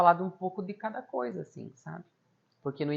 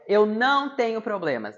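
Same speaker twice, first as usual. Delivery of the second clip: higher, louder, slow emphatic denial; mocking denial; 6 semitones higher, 6 dB louder than usual. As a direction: imitate an old lady scoffing at the idea. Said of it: "Tem um certo deboche até nisso".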